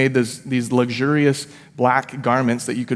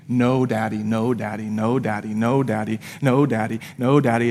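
ordinary talk, nothing marked

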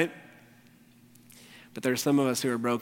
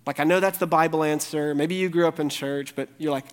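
normal talking voice

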